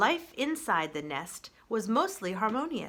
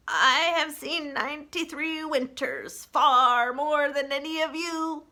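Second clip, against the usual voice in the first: slow, higher, weighty ancient turtle; slow old turtle; 6 semitones higher, 6 dB louder, slower than usual. Acted. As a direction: speak slowly like an ancient sage. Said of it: "Crag the turtle spoke slowly".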